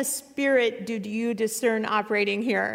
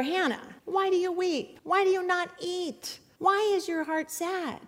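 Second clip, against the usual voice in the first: high, pleading husband's voice rising to a cry; 9 semitones higher than usual. Acted. Elkanah begging weeping Hannah to take comfort, high and pleading. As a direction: plead with your weeping wife, climbing higher. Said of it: "Elkanah, her husband, said to her".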